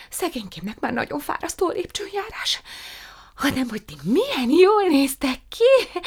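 high, breathless, excited chatter; gushing young woman. A narrator's reading. Prue greeting her cousin, gushing and high-pitched. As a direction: pour it all out in a rush of delight.

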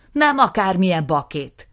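loud, furious outburst; angry accusation. Acted. Nisim accusing his brother, loud and furious. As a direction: spit out the accusation.

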